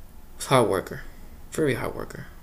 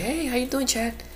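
fake friendly greeting; 12 semitones higher than usual. New supervisor's fake friendly greeting.

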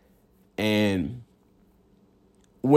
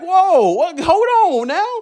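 high-pitched whoa, hold on; stunned disbelief; slower than usual. Retold or imitated. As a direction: react in disbelief at the TV. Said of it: "I'm like".

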